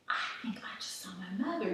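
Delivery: hushed unvoiced whisper. On a date, spotting her mother, stunned whisper. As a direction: whisper it so the date won't hear. Shocked aside on first date.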